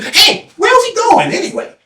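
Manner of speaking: high-pitched relayed shout; calling out. Acted. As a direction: call it down the line, way up high. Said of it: "calling out one after the other".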